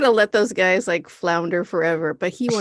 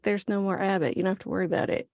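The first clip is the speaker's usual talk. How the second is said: softer, flat reassurance; dry deadpan; 9 dB quieter, much faster than usual. Retold or imitated. Ryan dismissing the monk, dry and soft. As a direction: brush him off under your breath. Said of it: "And Ryan's like".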